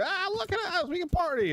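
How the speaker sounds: high, gleeful party squeal; cheerful high mockery of healthy patients; mock happy villagers. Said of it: "just going".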